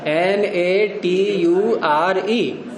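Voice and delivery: each letter spelled slowly and deliberately; slow spelling. Shown as a demonstration. Storyteller spells the word for students, slowly.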